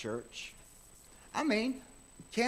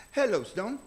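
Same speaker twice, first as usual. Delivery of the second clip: doctor's greeting; 6 semitones higher, 6 dB louder than usual. Retold or imitated. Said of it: "he talked with his German accent".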